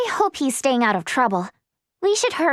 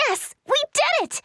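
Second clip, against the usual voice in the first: high, excited cheer; thrilled squeal of success; triumphant; 10 semitones higher than usual. Acted. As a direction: celebrate a hard-won victory. Celebrates passing the trials.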